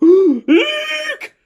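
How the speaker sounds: very high frightened squeak. Acted. Bear squeaking at the giant bee. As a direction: squeak in sudden terror. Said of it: "squeaked the bear".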